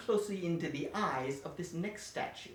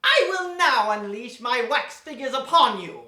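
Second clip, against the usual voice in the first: grand villain proclamation; 3 semitones higher, 9 dB louder than usual. Acted. Villain unleashes his figures.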